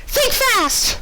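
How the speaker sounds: high, bubbly cartoon pony voice; squeaky pony girl; Pinkie Pie voice. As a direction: chirp it like a cheerful pony.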